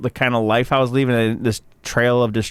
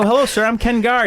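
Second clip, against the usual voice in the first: higher, polished salesman pitch; mock salesman greeting; 10 semitones higher than usual. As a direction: introduce yourself like closing a sale. Formal self-intro to booker.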